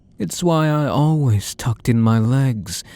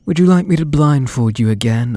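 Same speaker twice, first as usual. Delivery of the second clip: ordinary talk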